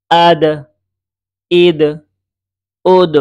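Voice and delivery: each syllable from "আক" to "উদ" loud, separate, with echoed final consonant; qalqalah pronunciation drill. Shown as a demonstration. Teacher reading without spelling, "ইক" and the rest firmly bounced. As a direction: punch "আব", pause, bounce every closing consonant. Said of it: "যেমন শুধু উচ্চারণ".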